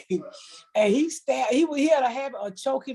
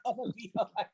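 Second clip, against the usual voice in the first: laughing; 3 dB quieter, much faster than usual. Real, not acted. Laughing through the line.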